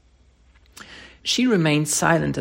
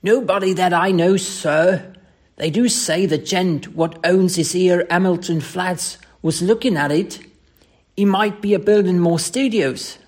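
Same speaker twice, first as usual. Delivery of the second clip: higher Cockney serving lad; chatty, deferential Cockney, pitched up; Cockney dialect; 3 semitones higher, 4 dB louder than usual. Acted. Thomas answers his employer about a buyer, lively Cockney. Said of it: "Thomas' Cockney dialect".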